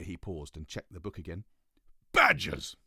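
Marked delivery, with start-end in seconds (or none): 2.1-2.8 shouted, pompous and high; loud royal proclamation. Acted. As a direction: proclaim it like a grand king. King Toothbrush Weasel proclaims.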